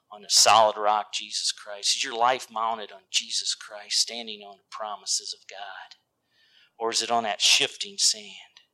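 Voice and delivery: low, soft, searching questions; hushed appeal. Real, not acted. Preacher asking the congregation about their lives, hushed. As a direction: press the question gently, almost whispering.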